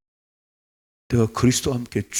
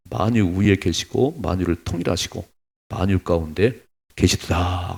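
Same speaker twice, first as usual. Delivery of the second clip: loud, slow, measured scripture recitation; a pastor reading the Bible aloud, solemn and loud; 2 semitones lower, 5 dB louder than usual. Acted. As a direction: proclaim the verse to the congregation. Reads Ephesians aloud.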